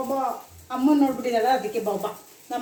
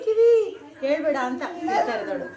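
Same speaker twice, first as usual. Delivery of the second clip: normal talking voice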